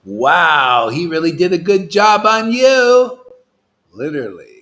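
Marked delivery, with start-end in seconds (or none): 0.1-3.2 loud, mock-amazed congratulation; sarcastic praise. Preacher mocking a rival guru's follower, loud sarcastic praise. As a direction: crow it loudly, drawing out the fake admiration.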